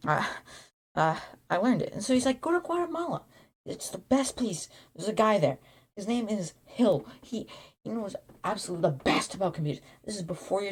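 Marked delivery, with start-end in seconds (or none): 2.4-4.7 mentor voice. Retold or imitated. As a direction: play the mysterious mentor giving orders. Mentor Bill giving travel advice.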